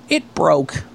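childlike excuse, mildly put on; Ray as a kid. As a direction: lightly imitate a kid owning nothing. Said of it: "like a kid, and he goes".

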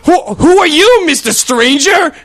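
high, loud, slow mock kid voice with laughter; hammed-up shrieking children; mocking kids shouting. Kids mocking the chaperone in the supermarket, high mock shout. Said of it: "they start to shout".

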